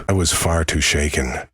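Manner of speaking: ordinary delivery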